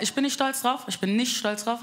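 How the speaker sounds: ordinary delivery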